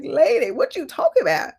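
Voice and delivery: laughing incredulity. Retold or imitated.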